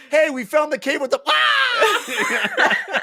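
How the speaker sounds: high, quick call breaking into a mock shriek; excited call cut by scream. Voicing companions attacked mid-sentence, comic scream. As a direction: call out brightly, then yelp mid-sentence.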